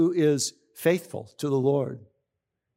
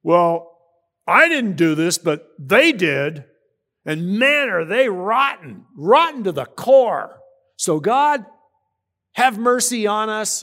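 louder, smug pharisee praying, slowing on "rotten"; mock self-righteous prayer; 4 semitones higher, 4 dB louder, slower than usual. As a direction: ham up a holier-than-thou prayer. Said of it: "they never prayed about their country saying".

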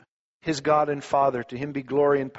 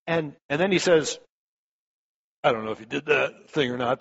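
ordinary talk, nothing marked

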